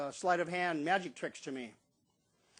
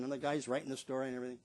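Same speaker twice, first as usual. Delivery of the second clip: normal talking voice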